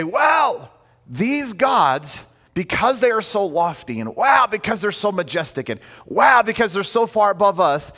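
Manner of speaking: high, exclaimed amazement at the gods; acted awe. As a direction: marvel aloud, pitch leaping up on each wow. Acting out ancient Greeks awed by gods.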